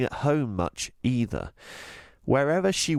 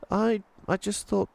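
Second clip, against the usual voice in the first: put-on young girl voice; 11 semitones higher than usual. Voices Chloe.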